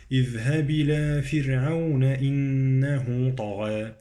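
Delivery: light "fir" in "فرعون", long nasal "إنه". Shown as a demonstration. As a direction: recite steadily with thin r in "فرعون". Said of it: "مرقق الراء".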